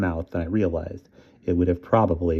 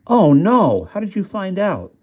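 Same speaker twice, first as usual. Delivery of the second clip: high, loud groan of alarm; boy hearing bad news, alarmed; dismayed; 12 semitones higher, 4 dB louder, slower than usual. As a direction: react with dread.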